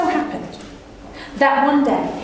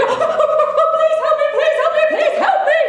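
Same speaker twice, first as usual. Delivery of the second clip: high pleading squeal for help; a hammed-up cry for help; mock screaming plea; 12 semitones higher than usual. Injured rabbit begging the brother gods for help. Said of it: "He was screaming".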